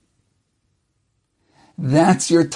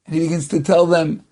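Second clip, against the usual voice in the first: ordinary talk, nothing marked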